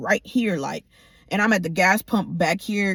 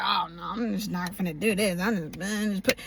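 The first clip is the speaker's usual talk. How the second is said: softer mock mumble of excuses; evasive mumbling; 2 semitones lower, 7 dB quieter than usual. Woman mimicking the caught man who won't talk, evasive mumble. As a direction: imitate someone dodging the question under their breath.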